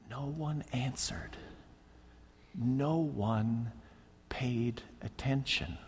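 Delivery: hushed whisper. Acted. Preacher hushing the congregation, whispering. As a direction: drop to a dramatic whisper. Dramatic hush in sermon.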